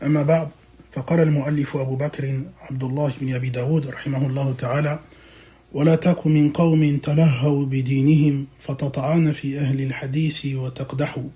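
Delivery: low, rapid, even-toned recital; formal Arabic recitation. Acted. Teacher opens a religious lesson with praise and a verse, formal recitation. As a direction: recite the opening formula low and quickly.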